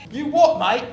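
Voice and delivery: put-on British accent, laughing a little; mock British accent. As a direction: copy the British line. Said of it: "Incoherent British".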